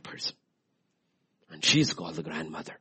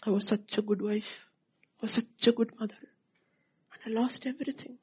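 1 self-pitying woman. Acted. Self-pitying wife voiced.

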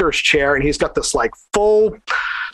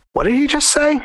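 higher-pitched mock bewilderment; stunned disbelief; 10 semitones higher than usual. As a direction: imitate a baffled bystander, pitched up. Retold stunned staff react.

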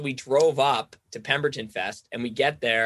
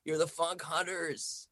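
softer, slow, dreamy stoner drawl; imitating a stoned girl; 4 dB quieter, slower than usual. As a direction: play a tripping fan in awe of the DJs. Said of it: "high on mushrooms or something".

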